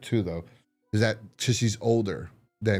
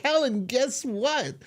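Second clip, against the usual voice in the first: very high, slow mock taunt; argumentative taunt; 12 semitones higher, slower than usual. Roommate starting an argument with Helen, high slow mock taunt. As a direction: sing out her name, imitating a needling roommate. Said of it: "they start arguing right away".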